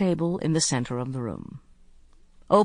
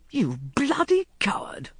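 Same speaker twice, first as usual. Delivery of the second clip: indignant cry; 6 semitones higher than usual. A narrator's reading. Robin protesting at the coward, crying out. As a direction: cry out in a strained voice. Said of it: "cried Robin".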